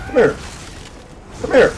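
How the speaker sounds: short, high, loud summons; calling out. Real, not acted. Player chasing an opponent, calling out loudly. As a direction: shout the opponent over.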